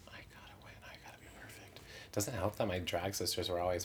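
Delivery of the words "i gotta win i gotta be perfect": breathless whisper, hardly there; anxious whisper. Retold or imitated. Psyching herself up before the crowning, whispered to herself. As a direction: whisper it like a frantic private mantra. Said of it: "Just like".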